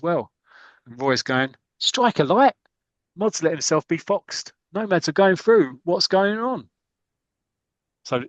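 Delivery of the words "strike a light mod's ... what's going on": high-pitched exasperated outburst; mock dismay. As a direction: act out a captain aghast at his defence. Roy acted aghast at defence.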